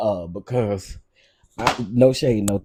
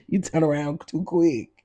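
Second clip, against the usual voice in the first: higher, scolding warning; higher mock scold laughing; 7 semitones higher than usual. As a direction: tell her off like a worried auntie. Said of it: "I said".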